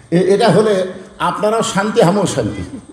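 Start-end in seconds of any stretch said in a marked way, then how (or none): none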